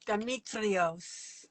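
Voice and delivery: put-on very slow Greek accent. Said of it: "Just rolls off the tongue".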